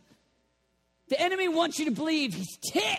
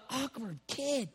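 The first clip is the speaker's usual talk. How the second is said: dismissive mutter; 3 semitones lower, 8 dB quieter, slower than usual. Acted. Mock dismissive God voice.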